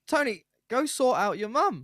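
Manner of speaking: high, loud mock pleading, a bit slow; exasperated urging. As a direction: push him to go help his mum, half laughing. Friends urging raider to leave.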